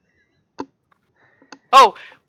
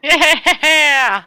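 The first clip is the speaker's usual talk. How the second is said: high, loud celebratory yeah; excited cheer; 8 semitones higher, 5 dB louder than usual. Real, not acted. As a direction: cheer the lucky roll out loud. Player cheers the roll result.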